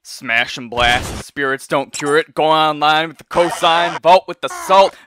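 hype intro. Acted. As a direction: kick off the video like a host. Rhyming show intro.

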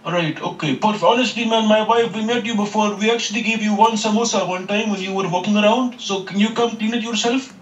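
high put-on foreign accent. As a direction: play a polite, worried tenant. Caller plays a new tenant.

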